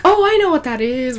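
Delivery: high, imitated know-it-all pupil outburst; eager excitement. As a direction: burst in like a kid dying to answer. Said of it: "jumping up and down".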